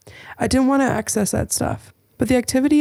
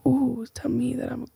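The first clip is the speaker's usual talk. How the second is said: whisper; 2 semitones higher, 6 dB quieter than usual. Pretend. Flirting in a whisper.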